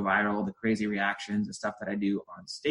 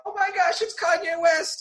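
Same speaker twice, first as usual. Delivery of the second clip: squealing, high-pitched star-struck cry; starstruck shriek; 4 dB louder than usual. Retold or imitated. Hypnotized girl spotting her favorite celebrity, high excited shriek. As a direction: shriek like you've just spotted your idol. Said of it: "crying out".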